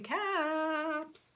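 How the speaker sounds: high, slow singsong calling; peddler's sales call. Acted. Peddler calling to town, selling caps slowly. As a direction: call out your wares slowly. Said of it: "walked back to town calling".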